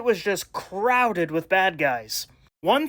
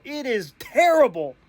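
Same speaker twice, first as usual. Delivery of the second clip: usual speaking voice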